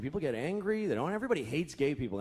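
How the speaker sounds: ordinary delivery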